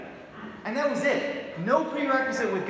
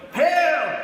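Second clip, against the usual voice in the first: loud, higher, hammed-up preacher bark of "Hell!"; preacher's shouted hell; 6 semitones higher, 8 dB louder than usual. Mocking a street preacher, shouting "Hell!" in a mock twang. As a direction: blast "Hell!" like a pulpit thunderer. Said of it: "Hell and God".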